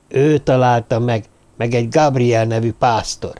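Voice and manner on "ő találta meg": usual speaking voice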